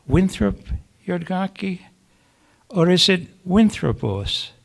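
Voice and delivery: a father's vague, puzzled question in a poem. Acted. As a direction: ask it vaguely, pausing between guesses. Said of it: "Then comes his vague".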